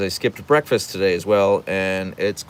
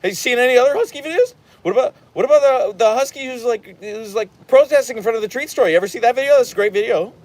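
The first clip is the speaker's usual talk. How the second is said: much higher, fast, louder gushing questions; overeager rapid fire; 12 semitones higher, 5 dB louder, faster than usual. Retold or imitated. Imagined reply to a husky fan, fast, high and overexcited. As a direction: bombard the stranger with eager questions. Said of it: "But I wanna be like".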